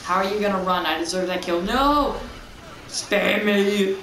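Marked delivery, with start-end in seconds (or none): 1.6-2.2 sudden high yell of no; loud startled no; panicked shout. Real, not acted. Dying in sniper battle. 2.9-4.0 mock plea for mercy. Real, not acted. Begging enemy while losing.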